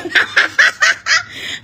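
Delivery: high cackle; real amused laughter. Real, not acted. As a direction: cackle at the joke. Laughing at viewer reactions.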